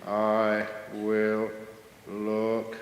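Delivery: one word at a time, long pauses; dreamily slow, syllables stretched out; ultra-slow talker. Acted. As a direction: speak at a tortoise's pace. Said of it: "who can't even talk fast".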